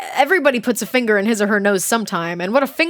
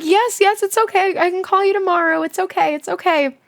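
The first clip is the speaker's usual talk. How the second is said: mock sweet reassurance; 8 semitones higher than usual. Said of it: "And I was like".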